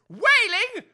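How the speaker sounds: loud, indignant repeat of the word; outraged echo. Acted. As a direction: spit the word back, affronted. Offended major repeats insult.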